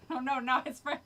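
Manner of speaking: ordinary delivery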